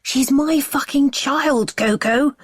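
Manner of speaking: high furious claim. Acted. Husband claiming the child.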